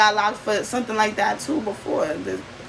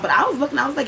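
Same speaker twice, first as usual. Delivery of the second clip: ordinary talk, nothing marked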